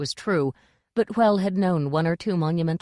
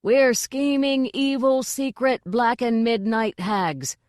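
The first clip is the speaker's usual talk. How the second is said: high, slow, fervent chant; rousing drawn-out group shout; chorus yell; 5 semitones higher, slower than usual. A narrator's reading. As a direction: chant each word out high. Witches yell back in chorus.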